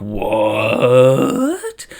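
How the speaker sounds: long drawn-out rising question; stunned disbelief. Acted. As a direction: stretch the word slowly upward in disbelief. Told they are free, stunned reaction.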